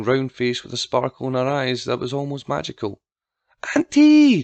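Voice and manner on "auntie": high, loud, drawn-out excited greeting; joyful cheer. A narrator's reading. Girl greets her visiting aunt, joyful cheer. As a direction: call out in delight, much higher and louder. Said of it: "Tabitha cheered".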